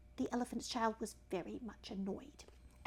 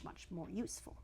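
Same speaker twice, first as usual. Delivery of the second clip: ordinary delivery, nothing marked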